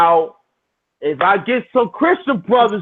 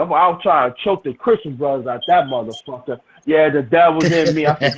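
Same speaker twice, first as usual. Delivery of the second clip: normal talking voice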